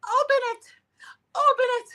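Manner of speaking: hammed-up shriek of open it; mock yell.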